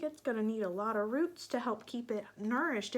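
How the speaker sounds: plain reading voice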